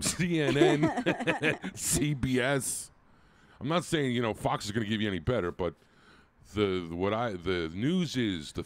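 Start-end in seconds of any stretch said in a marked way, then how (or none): 0.0-2.9 laughing mockery. Real, not acted. Mocking news sources.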